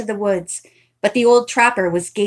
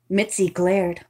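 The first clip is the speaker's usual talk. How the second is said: plain reading voice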